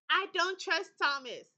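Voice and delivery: punching out her suspicion; loud distrust. Real, not acted. Player insists she distrusts Thomas, loud and firm.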